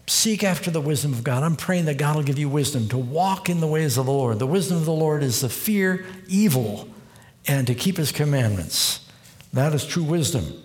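ordinary delivery

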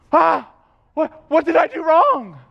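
high, loudish panicked stammer; startled, fearful child. Acted. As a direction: act a kid caught out. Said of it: "You're full of fear".

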